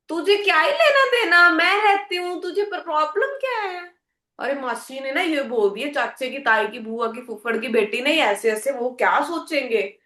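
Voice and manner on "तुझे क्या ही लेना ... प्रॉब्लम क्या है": mock-defiant talking back; defiant retort. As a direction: snap back at a nosy neighbour. Acted retort to a nosy critic.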